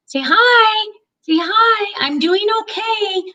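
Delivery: high sing-song coaxing. Real, not acted. Voicing and coaxing the dog.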